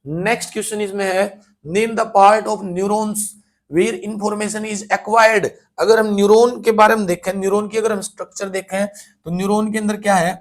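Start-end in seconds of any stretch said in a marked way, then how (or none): 1.6-5.5 very slow, deliberate dictation of the question; slow question reading. Shown as a demonstration. Teacher to class, reading the exam question slowly. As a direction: read it out word by word for students.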